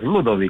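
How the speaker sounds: "Ludovic" said the Romanian way, LU-do-vic, hard c, no French accent; Romanian pronunciation. Shown as a demonstration. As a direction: say "Ludovic" plainly, Romanian style. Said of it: "Ludovic cu accent românesc". („Ludovic” pronunțat românește, nu franțuzește)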